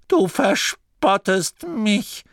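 slow, high wounded whine; complaining. Acted. The abbot complains to Masklin, slow and high and hurt. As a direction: plead that you are being mocked. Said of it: "klagte der Abt".

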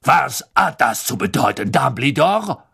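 imperious giantess, commanding. Acted. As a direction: demand an answer imperiously. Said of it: "sagte sie in gebieterischem Ton".

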